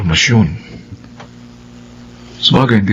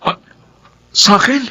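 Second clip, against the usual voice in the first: stunned; 11 semitones higher than usual. Acted. Accused boyfriend stunned, stammering.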